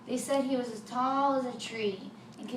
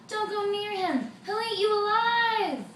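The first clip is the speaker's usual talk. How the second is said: much higher, louder cry; yelled warning; 10 semitones higher, 6 dB louder than usual. Acted. Townsfolk yell at the boy and father to stay away. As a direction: shout across the field to stop them. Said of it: "People yelled".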